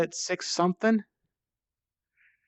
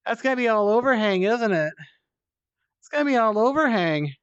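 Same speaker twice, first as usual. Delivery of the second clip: higher, louder anxious groan about the climb; worried; 6 semitones higher, 5 dB louder than usual. Real, not acted. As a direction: fret out loud, dreading what's ahead. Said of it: "here's what I'm worried about".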